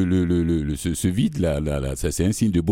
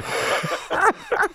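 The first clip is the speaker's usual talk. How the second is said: high, breathy burst of laughter; real laugh at a joke. Real, not acted. Host laughs at dentist joke.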